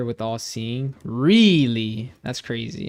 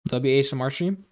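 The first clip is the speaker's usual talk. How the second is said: mouth full; 4 dB quieter, faster than usual. Real, not acted. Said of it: "I don't know if you can hear me chewing".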